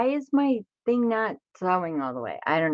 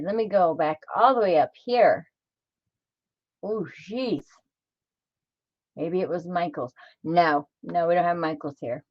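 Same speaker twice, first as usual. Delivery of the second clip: ordinary talk, nothing marked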